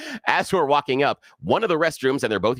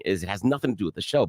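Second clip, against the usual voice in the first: ordinary talk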